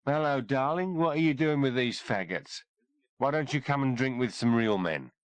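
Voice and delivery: put-on leering drunk, words running together a little; loud slurred drunk. Drunk soldier hits on the doctor at the bar, loud and slurred. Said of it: "in a loud, slurred voice".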